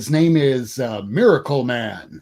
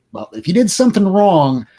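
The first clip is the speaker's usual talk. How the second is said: ordinary delivery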